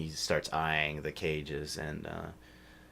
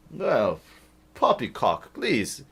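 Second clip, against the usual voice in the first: dismissive master; 6 semitones higher, 13 dB louder than usual. Acted. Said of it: "says the human".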